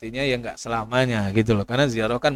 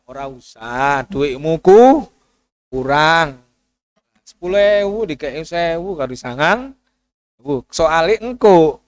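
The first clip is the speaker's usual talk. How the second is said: tempting devil; 2 semitones higher, 6 dB louder than usual. Retold or imitated. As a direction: drag it out to tempt. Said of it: "setane sing goda".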